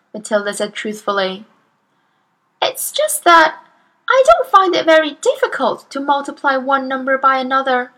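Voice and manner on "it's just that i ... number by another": put-on little girl voice. Narrator voices young Matilda.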